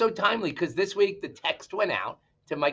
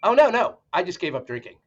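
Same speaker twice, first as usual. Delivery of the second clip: punchline exclamation; 5 semitones higher, 7 dB louder than usual. Acted. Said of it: "And he goes".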